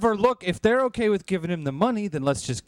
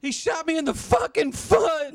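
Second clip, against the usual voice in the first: pained shout; 11 semitones higher than usual. Acted. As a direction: yell it through the shock. Just got shot in foot.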